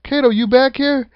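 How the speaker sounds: imitating a wrestler calling for his partner. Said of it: "you hear Joe go".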